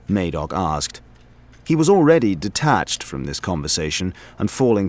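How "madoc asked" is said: ordinary delivery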